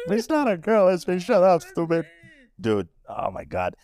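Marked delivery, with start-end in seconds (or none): 0.1-2.1 high, jokey comeback to the neighbors; mock indignant retort. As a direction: snap back in mock offense, pitched up.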